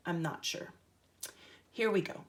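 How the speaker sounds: ordinary delivery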